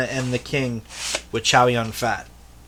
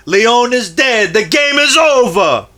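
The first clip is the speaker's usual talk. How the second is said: Danny Aiello movie shout; 11 semitones higher, 8 dB louder than usual. Acted. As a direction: belt out the film quote at full excitement. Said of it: "In the words of Danny Aiello".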